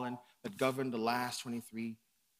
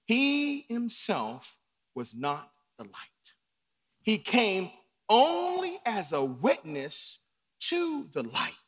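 slow, deliberate reading of the verse; scripture reading; 6 semitones higher, 3 dB louder, slower than usual. Shown as a demonstration. Preacher reading verse eight slowly to the congregation. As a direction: read the verse with weight. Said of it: "Turn with me ... God's word".

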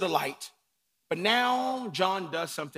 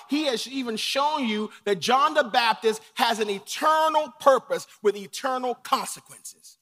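louder, rising sermon cadence; full-force preaching; 5 semitones higher, 7 dB louder than usual. Acted. Preacher stirring his church about John's role, full force. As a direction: lift the congregation with each line.

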